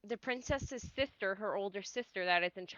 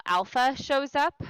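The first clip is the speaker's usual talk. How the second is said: normal talking voice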